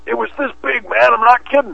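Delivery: fish-story braggart. Retold or imitated. Mimicking a game voice boasting about a fish, high and put-on. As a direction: oversell the size of the catch. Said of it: "the guy who went".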